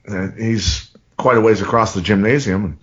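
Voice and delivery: ordinary delivery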